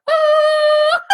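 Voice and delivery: high, loud whoop breaking into a laugh; joyful shout. Retold or imitated. Grandparents celebrate her letting go, high whooping laugh. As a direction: burst out in a shrieking happy laugh. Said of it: "And they're like".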